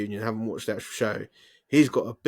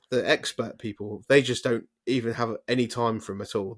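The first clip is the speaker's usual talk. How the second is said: usual speaking voice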